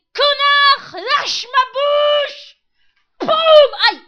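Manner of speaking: slow, high furious shout; angry yelling. Pretend. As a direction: scream at the dentist. Imagined yell at dentist.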